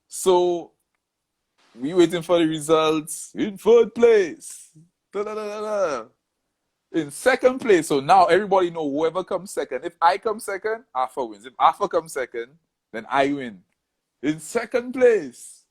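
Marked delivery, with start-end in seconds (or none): 3.3-6.1 announcer voice pitched higher, drawn out slowly; mock announcer. Announcer reading the results. 6.9-7.9 higher announcer call, steady pace; mock announcer. Announcer reading the results. 14.2-15.6 higher and slow, drawing out the result; suspenseful announcer. Retold or imitated.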